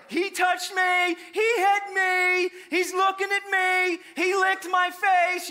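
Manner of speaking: very high, loud, slow whine; whiny tattling kid. Retold or imitated. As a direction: tattle like a fed-up child. Squabbling kids tattling.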